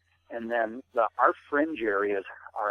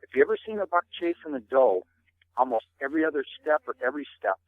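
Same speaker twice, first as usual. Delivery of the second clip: ordinary delivery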